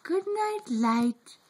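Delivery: usual speaking voice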